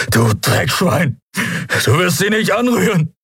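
strained, high outburst of rage; furious threat. Acted. Prisoner defending his friend against the commodore, furious.

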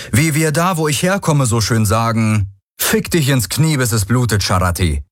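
a fuming rebel telling them off; angry cocky hero. Acted. Hero insults the queen.

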